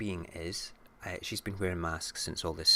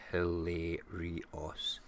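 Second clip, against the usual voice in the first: drawn-out relish; much slower than usual. Real, not acted. Savouring word drawn out.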